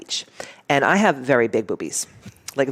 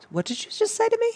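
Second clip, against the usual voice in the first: much higher, confronting retort; powerful comeback; 5 dB quieter than usual. Shown as a demonstration. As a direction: demand it back, stunned and challenging. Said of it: "such a powerful thing just to go".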